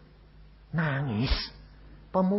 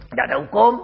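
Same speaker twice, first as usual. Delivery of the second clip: louder, insistent repeat of the line; emphatic repeat; 4 semitones higher, 11 dB louder, faster than usual. Retold or imitated. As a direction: hammer it home the second time. (mengulang dengan lantang)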